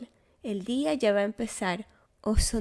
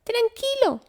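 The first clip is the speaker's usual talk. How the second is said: very high little squeak, soothing; a tiny mouse calming the bear; squeaky mouse; 4 dB louder than usual. A narrator's reading. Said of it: "chilla ratón".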